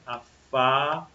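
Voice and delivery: Afável split slowly, FÁ held long, then a gap before vel; syllable by syllable. Shown as a demonstration. Teacher separating syllables for pupils, syllable by syllable. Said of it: "separação silábica".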